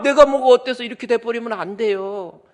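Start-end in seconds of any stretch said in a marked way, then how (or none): 0.0-0.8 loud, high indignant comeback; defiant retort. Retold or imitated. Preacher mocking a rebuked believer, loud indignant comeback. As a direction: snap back indignantly, higher and louder. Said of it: "막 지적하면".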